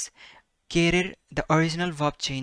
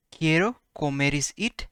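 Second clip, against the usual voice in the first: normal talking voice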